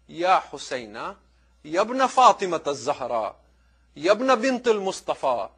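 ordinary talk, nothing marked

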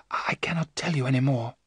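faint breathy whisper. Acted. Hypnotized Mina whispering to the professor. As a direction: let it fade to a bare whisper.

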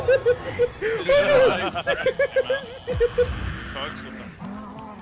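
high squealing laughter; long helpless laughing fit. Real, not acted. Cracks up after chase.

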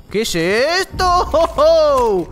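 high, loud, stretched amazement; drawn-out astonished question; surprised. Real, not acted. Sees surprising effect.

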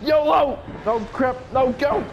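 high, loud burst of bravado; frantic yell. Real, not acted. Player charging off past the threat, frantic yelling. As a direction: yell it as you make a dash.

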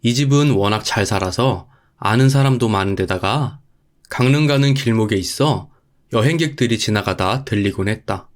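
ordinary delivery

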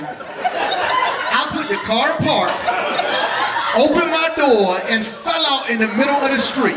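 high voice, laughing through the words; telling it while cracking up; laughing hard. Real, not acted. Retelling falling out laughing.

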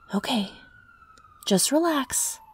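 whisper. Acted. Calming her before ambulance.

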